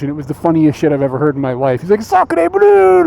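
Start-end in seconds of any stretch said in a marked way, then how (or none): 2.0-3.0 high, loud mock French exclamation; French swearing. Imitating French streamer swearing, hammed-up French accent. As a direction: cry it like a cartoon Frenchman. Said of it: "started swearing in, uh, French".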